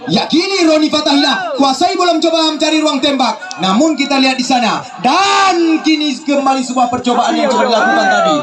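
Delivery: high excited calls; excited commentary. Real, not acted. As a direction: lift with the attack. Attack in second half.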